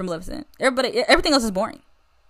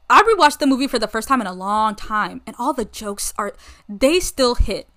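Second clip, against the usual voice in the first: usual speaking voice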